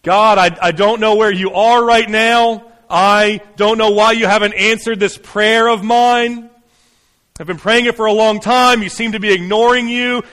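raw, urgent prayer; anguished honest prayer. Acted. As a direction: pour out frustration straight to God.

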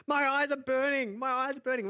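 eyes stinging, crying out; pained wail. Retold or imitated. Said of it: "He's like".

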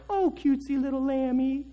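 high, soft cooing over a pet; cooing baby talk. Acted. Poor man cooing tenderly to his lamb, high and soft. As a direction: coo at the little lamb.

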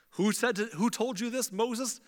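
incredulous mock Pharaoh asking who said so; mocking disbelief. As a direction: sneer at the idea like Pharaoh.

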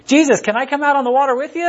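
high-pitched, gushing excitement; excited Peter. Acted. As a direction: act out an over-eager follower, voice jumping way up. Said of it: "Peter gets all excited".

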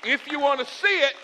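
loud, high slogan with a pause before the payoff; full-force preaching. Acted. Pastor driving home his principle, full-force preaching. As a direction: fire out the refrain to the crowd.

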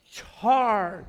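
loud charge cry; shouted charge. Shown as a demonstration. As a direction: call it out as the congregation's answer. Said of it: "Thank you".

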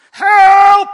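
loud, high-pitched cry for help; panicked yell. Acted. Man in gorilla suit to approaching lion, panicked yell. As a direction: yell it out at full force. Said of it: "If I yell".

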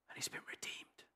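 hushed whisper. Real, not acted. Preacher at the sermon's tender climax, whispered. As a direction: breathe the words out in a whisper.

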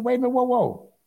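ordinary talk

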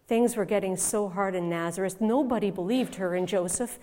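normal talking voice

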